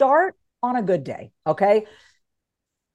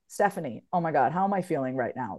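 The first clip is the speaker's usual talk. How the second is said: soft self check-in; 7 semitones lower, 7 dB quieter than usual. Shown as a demonstration. Asking herself how she feels, soft and kind. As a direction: lower and softer, gently asking. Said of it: "Or you can say".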